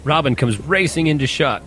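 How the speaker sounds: normal talking voice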